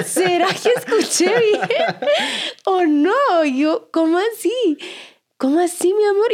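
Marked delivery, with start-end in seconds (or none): 0.0-3.4 can't believe what she just heard, laughing; laughing disbelief. Retold or imitated. Mom hearing her son's odd answer, laughing in disbelief. 3.9-6.3 rising disbelief, pressing him gently; baffled surprise. Retold or imitated. Mom asking her son what he meant, high baffled surprise.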